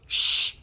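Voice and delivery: sharp unvoiced shh; hissed shush. A narrator's reading. Rose silencing Murden with a sharp shush. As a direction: shush him fast. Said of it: "Rose hissed".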